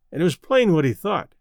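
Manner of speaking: ordinary delivery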